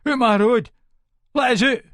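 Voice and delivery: high, loud frightened yell; panicked shout. Acted. Intruder shouting in terror to escape. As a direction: yell to get out past him. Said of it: "he shouts".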